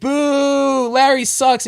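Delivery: high, loud booing; hammed-up heckle; mock booing crowd. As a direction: jeer like a crowd.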